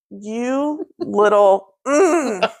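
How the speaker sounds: slow, held-back scolding; retold exasperation. Owner to sprinting dog, slow stifled exasperation. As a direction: break off into a frustrated mm.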